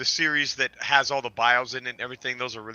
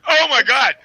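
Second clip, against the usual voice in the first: shocked; 9 semitones higher, 10 dB louder than usual. Real, not acted. Shocked outburst at dick pasta.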